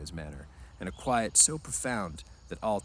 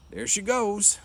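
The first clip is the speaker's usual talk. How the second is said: loud, much higher hearty greeting; a burly ranch hand greeting the newcomers, loud and hearty; hearty big man; 13 semitones higher, 6 dB louder, slower than usual. Acted. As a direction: boom it out like a big friendly man. Carlson hears the triangle.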